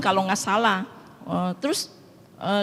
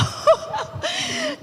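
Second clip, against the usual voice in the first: very high giggle; high, amused peal of laughter; real laugh. Real, not acted. Amused by her own blunt remark.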